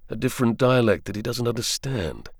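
usual speaking voice

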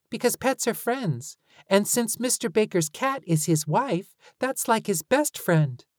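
put-on high, earnest kid voice; child voice. A narrator's reading. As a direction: work it out like a kid. Lucy voiced, reasoning.

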